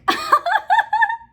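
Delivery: loud, high-pitched cackling laugh; real laughing fit. Real, not acted. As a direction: burst out laughing, helpless. Cracks up after repeating slip.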